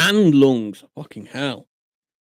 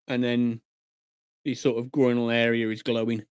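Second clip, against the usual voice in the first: usual speaking voice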